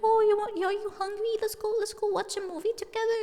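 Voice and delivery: high pitched cooing at a doll; cute doll talk. Retold or imitated. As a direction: coo sweetly to the baby doll. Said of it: "going like".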